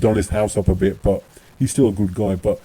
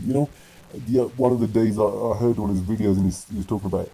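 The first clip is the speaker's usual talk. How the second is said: ordinary talk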